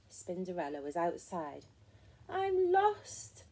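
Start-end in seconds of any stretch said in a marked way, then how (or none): 2.3-3.4 high-pitched storybook wail; plaintive cry, pitched way up. A narrator's reading. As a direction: wail it like a lost little creature. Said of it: "she wailed".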